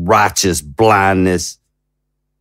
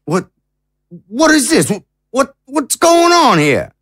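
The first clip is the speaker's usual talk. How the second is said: much higher, stammering alarm; shocked disbelief. Acted. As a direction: sputter in sudden shock. Told the summit ended without him.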